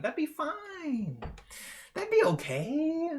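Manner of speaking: high, drawn-out mock reply to the partner; high mock answer. Streamer acting out a silly answer to a partner, high and drawn out. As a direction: put on a high silly voice and stretch the end. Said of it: "Be like".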